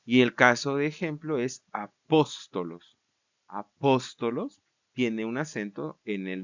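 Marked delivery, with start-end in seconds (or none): 1.7-3.0 "apóstolos" stressed on PÓS; antepenultimate stress. Shown as a demonstration. Teacher introducing the Greek example word, stress on PÓS in "apóstolos". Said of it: "en la antepenúltima sílaba". 3.5-4.6 "Apóstolos" with PÓS stressed; antepenultimate stress. Shown as a demonstration. Teacher explaining the nominative accent, stress on PÓS in "Apóstolos". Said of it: "en la antepenúltima sílaba".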